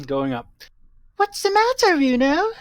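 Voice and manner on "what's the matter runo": much higher put-on character voice. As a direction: ask it in a high, sing-song character voice. Voicing a game character.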